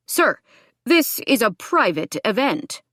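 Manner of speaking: minister announcing. A narrator's reading. Minister confronts an intruder at the baptism, announcing. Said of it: "the minister announced".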